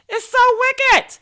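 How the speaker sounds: "It's so wicked." in a high, slow, hammed-up squeal. Shown as a demonstration. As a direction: stretch "It's so wicked." up high in mock outrage.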